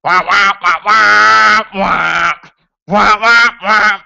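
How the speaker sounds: imitating their awful music with wah wahs; mocking wah wahs. Mocking a band's atrocious music with loud wahs. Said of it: "That's actually better than how their music is".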